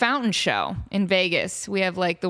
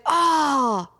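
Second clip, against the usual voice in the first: mock eager dude; 6 semitones higher than usual. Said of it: "the dude's like".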